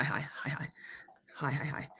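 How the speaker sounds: whispered little greeting to chat. Real, not acted. Streamer whispering hellos to her chat. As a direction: breathe the his softly, no voice.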